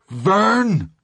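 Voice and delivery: high, loud accusing yell; judgment-day call; terrible accusing cry. Acted. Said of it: "cried in a terrible, accusing ... right early voice".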